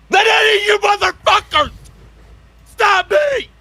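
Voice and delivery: loud, high defiant yell; defiant shout. Acted. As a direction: yell it at everyone. Defiant outburst.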